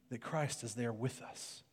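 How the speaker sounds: ordinary delivery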